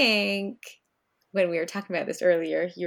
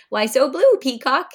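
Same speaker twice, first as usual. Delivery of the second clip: higher, louder teasing grandpa voice; chuckling old peacock; 5 semitones higher, 8 dB louder than usual. A narrator's reading. Pop Peacock teasing his sad grandson. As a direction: tease him gently with a pun. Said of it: "He chuckled at his own pun".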